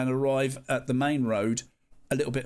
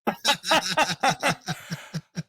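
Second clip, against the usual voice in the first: real laugh; 4 semitones higher than usual. Real, not acted. Laughing at the memory.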